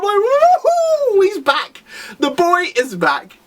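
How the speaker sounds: high, whooping cheer. Real, not acted. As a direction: celebrate like the hero just walked in. Cheering the hero's return.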